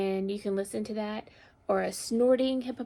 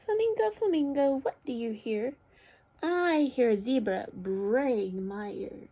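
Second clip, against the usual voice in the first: picture-book read-aloud; 3 semitones higher, 3 dB louder than usual. A narrator's reading. Teacher reads animal story to children, expressive storytelling. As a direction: call the animal, then stretch the sound word.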